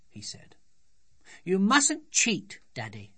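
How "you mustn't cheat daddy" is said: put-on high, slow, scolding child; stern childish reprimand, drawn out; severe little girl. A narrator's reading. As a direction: tell daddy off sternly in a high voice. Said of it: "said Sophie severely".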